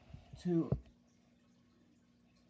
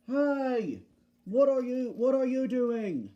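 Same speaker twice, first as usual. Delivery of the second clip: loud, high-pitched challenge; calling out; 7 semitones higher, 4 dB louder than usual. Acted. Frank challenging Bulgy about the passengers, loud high shout. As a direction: call out to stop the culprit.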